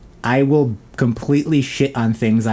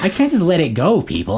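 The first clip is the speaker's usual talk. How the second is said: usual speaking voice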